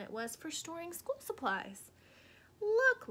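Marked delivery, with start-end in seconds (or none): 2.6-3.1 loud, high, urgent look; grabbing everyone's attention; fierce whisper. Acted. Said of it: "Lily whispered fiercely".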